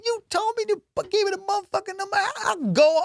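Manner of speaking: very high, fast put-on rant; hammed-up furious comeback; mock angry guard. Security guard ranting back at the lady, high fast mock rant. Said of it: "they're arguing in front of everybody".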